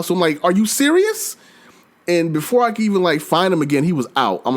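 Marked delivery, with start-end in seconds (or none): 0.4-1.4 high-pitched exasperated question; retold incredulity. Player to a monster leaving five minutes in, incredulous. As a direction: demand it in mock outrage.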